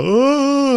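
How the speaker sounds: hammed-up high loud wail; mock crab-victim scream. Podcaster acting out the crab snipping someone, mock high wail. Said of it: "you just see it go".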